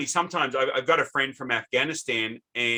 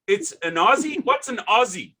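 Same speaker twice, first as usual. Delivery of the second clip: higher-pitched puzzled questioning; baffled caller; 8 semitones higher than usual. Retold or imitated. Host imitating the caller puzzled by Aussie, baffled.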